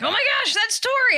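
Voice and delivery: excited squeal. Retold or imitated. Daughter spotting her friend's car, excited squeal. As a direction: squeal it like a thrilled kid.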